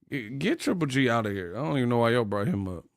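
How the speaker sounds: ordinary talk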